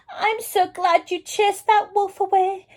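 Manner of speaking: put-on high, glad girl voice; girl thanking Granny, high and glad; happy relief. A narrator's reading. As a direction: gush with relief.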